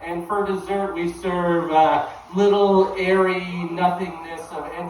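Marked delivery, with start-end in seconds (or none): none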